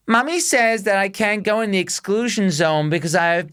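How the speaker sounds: put-on high, prim little-kid voice; posh toddler voice. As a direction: mimic an overly articulate toddler. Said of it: "They're like".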